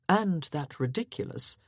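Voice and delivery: ordinary delivery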